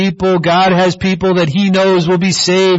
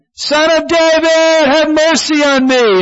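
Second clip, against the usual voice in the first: louder cry; 13 semitones higher, 3 dB louder than usual. Retold or imitated. Blind man crying out louder to Jesus. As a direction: cry out all the more, louder and more animated. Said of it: "He cries out all the more, louder, more animated".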